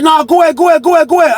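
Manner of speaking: high repeated shout back; shouted reply. Retold or imitated. Jersey telling the narrator to take the phone, shouted back.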